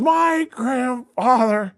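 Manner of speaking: put-on very slow, high drunken proclamation; drunken boast. Drunk actor defending his family to a roasting comic, imitated drunken boast. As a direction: imitate a drunk drawing out every word. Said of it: "he's drunk and he's trying to tell me".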